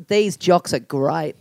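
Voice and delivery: broad Aussie, very slow and low; "great" stretched toward "grite" in broad Australian; Australian accent. Shown as a demonstration. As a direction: model a thick Australian accent slowly. Model line for accent imitation.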